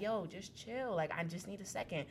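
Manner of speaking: soft, subdued imitation of the ex; calming plea. Ex asking her to wait, softly. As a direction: back off quietly, asking for patience.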